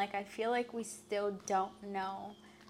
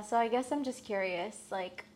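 usual speaking voice

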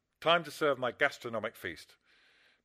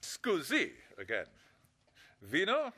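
mock Italian; 4 dB quieter, slower than usual. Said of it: "that phony Italian accent".

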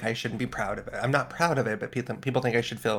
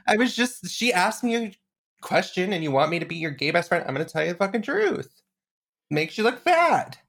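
indignant bluntness; 8 semitones higher than usual. Retold or imitated. Defends blunt answer to friend.

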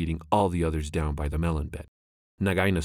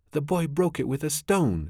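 shrieking; 10 semitones higher than usual. Acted. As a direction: shriek it out in panic. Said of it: "shrieked Darzee's wife".

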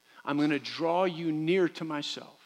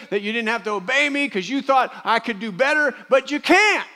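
voicing God, louder and higher, building to a peak; preaching at full force; 6 semitones higher, 7 dB louder than usual. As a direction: drive it home like a preacher in full flow. Preacher voicing God, rising intensity.

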